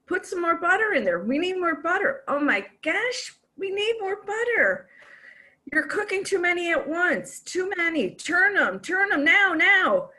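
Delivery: high, fussy, panicky husband voice; frantic husband. Retold or imitated. Husband fussing at wife over frying eggs, high and panicky. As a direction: fret over the pan like a nervous husband. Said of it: "he says".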